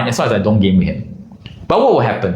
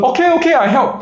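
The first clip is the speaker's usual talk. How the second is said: high, meek quick okay; caving in under a glare; meek surrender. Retold or imitated. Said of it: "and then he goes".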